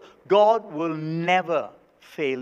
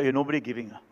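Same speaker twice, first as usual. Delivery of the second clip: grudging, muttered low and soft under the breath; mock grudging giver mumbling; grudging mumble; 7 semitones lower, 5 dB quieter, much faster than usual. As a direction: sound like a reluctant giver, lah. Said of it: "Don't say".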